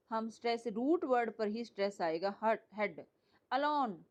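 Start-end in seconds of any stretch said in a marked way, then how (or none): 3.5-4.1 "alone" with a weak अ and stressed लोन; stress on second syllable. Shown as a demonstration. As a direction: lean on लोन in "alone", keep अ light. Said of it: "अ पे नहीं आएगा, लाउन पे आएगा". ("Alone" में अ हल्का, लोन पर ज़ोर)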